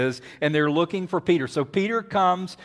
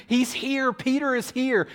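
Rhoda; 9 semitones higher than usual. Acted. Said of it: "Rhoda tells them again".

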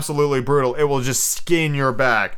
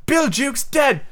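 high-pitched shocked pirate cry; startled cry; 14 semitones higher, much slower than usual. A narrator's reading. Pirates hearing of Jukes's death, startled cry. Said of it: "cried the startled pirates".

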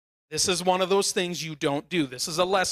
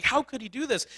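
upset; 6 semitones higher than usual. Retold or imitated. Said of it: "You get emotional and you get upset".

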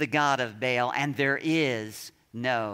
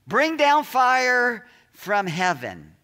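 loud pleading cry, drawn out slowly; calling out; 8 semitones higher, 9 dB louder, slower than usual. Retold or imitated. Prophets of Baal calling on their god, loud drawn-out cry. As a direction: cry out to Baal, higher and louder. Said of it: "calling out".

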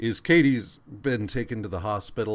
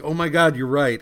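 higher, louder mock epiphany; sudden realization; 5 semitones higher, 8 dB louder than usual. Johnny suddenly convinced by his friend, hammed-up excitement. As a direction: blurt it out like a lightbulb just went on. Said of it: "And Johnny's like".

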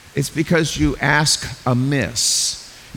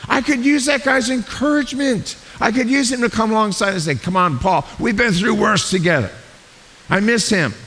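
higher, aching voice pining for a lost friend; hurting Paul; 8 semitones higher than usual. Retold or imitated. Paul, missing Barnabas, voices his lonely ache with sadness. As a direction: say it as if missing your best buddy. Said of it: "I think he's going".

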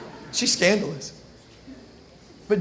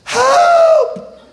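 much higher, louder, mock screaming help; crying for help; 6 dB louder, much slower than usual. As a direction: shriek it like a faked alarm. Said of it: "she begins to cry out".

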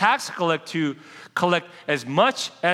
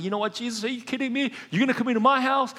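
higher, fast, disbelieving rush; geeked out; 5 semitones higher, faster than usual. Retold or imitated. Zacchaeus stunned that Jesus will visit, excited disbelief. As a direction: gush like a stunned tax man hearing Jesus is coming over. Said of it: "totally geeked out".

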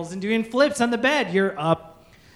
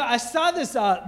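normal talking voice

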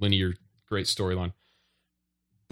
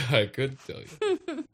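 laughing echo; 5 semitones higher, slower than usual. Real, not acted. Echoing with a laugh.

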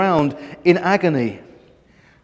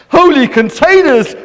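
a higher, louder exclamation; exclaiming; 7 semitones higher, 4 dB louder than usual. A narrator's reading. Herbert dismayed at his smashed flask, crying out. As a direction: cry it out in dismay. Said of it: "cried Herbert".

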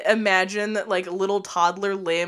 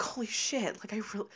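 very soft, awed aside; retold secret realization; hushed disbelief; 9 dB quieter, faster than usual. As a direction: say it under your breath, amazed you got away with it.

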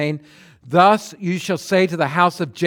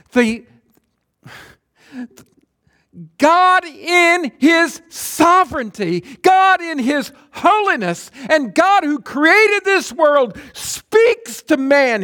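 high, loud, fervent preacher's cry; preaching at full force; 10 semitones higher, 6 dB louder than usual. Acted. Preacher answering doubters about the commandments, loud fervent cry. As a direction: thunder it out to the congregation.

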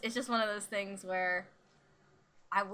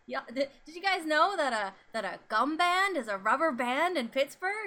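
lightly voiced teacher, higher and louder; eager substitute teacher; 6 semitones higher, 5 dB louder than usual. Substitute teacher quizzing the class on slang, eager. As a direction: quote an excited teacher, pitched up. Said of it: "substitute teacher ... who was like".

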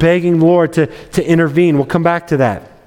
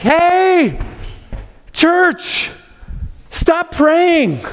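high, urgent call to the room; excited servant girl; 9 semitones higher, much slower than usual. Acted. Servant girl Rhoda calling to the praying church, high and urgent. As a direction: burst in and call out, much higher.

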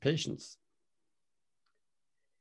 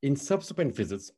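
ordinary delivery

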